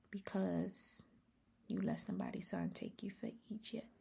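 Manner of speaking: soft unvoiced confiding; whispered aside. Real, not acted. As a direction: whisper it close to the mic. Whispered punchline.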